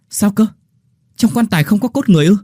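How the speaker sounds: high, loud and fast disbelief; shocked question. Acted. Villagers call down to the diggers in loud disbelief. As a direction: blurt it out in shock. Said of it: "Cả bảy đồng thanh hỏi".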